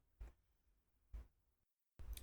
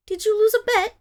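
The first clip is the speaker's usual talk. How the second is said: mocking taunt; 11 semitones higher, 6 dB louder than usual. Mocking the silent buyer.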